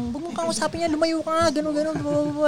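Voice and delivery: high, soft imitation of scolding; mock scolding. Woman telling someone to stay away from Tito Edgar, mock scolding. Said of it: "Pinagmumura si Tattoo Edgar".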